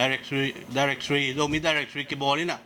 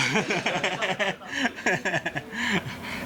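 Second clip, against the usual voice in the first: long bubbling laugh, higher than the speaking voice; real laughter; 4 semitones higher than usual. Real, not acted. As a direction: crack up mid-report. Speaker cracks up mid-report.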